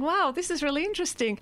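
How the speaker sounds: high, far-off wondering voice; naive wonder. Retold or imitated. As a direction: sound innocently amazed.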